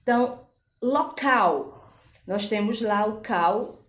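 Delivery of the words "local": local slowed, final cal drawn out strongly; stress on CAL. Shown as a demonstration. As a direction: stretch and stress the last syllable of local. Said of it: "A sílaba tônica é a última". (local devagar, com força no cal final)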